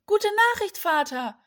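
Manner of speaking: high, loud shout of good news; excited call. A narrator's reading. Sons calling good news to their father, excited shout. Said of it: "riefen ihm seine Söhne entgegen".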